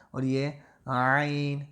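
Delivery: عین drawn out from the throat, very slow; throaty ain. Shown as a demonstration. Teacher showing the throat sound of عین, very slow. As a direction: stretch عین slowly from the throat. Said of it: "گلے سے آواز نکالنی ہے".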